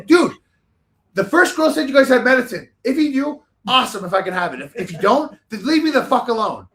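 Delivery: loud, high, fed-up retort re-enacted; agitated outburst. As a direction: snap at them as if tired of repeating yourself. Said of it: "agitated as hell now".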